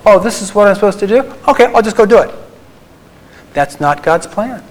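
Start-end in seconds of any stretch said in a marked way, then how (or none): 0.1-2.3 quick, higher, bright-eyed voice of someone who thinks it's easy; mock eager "I'll just go do it"; naive eager self-talk. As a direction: play the overconfident beginner, raised and quick. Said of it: "Human nature says".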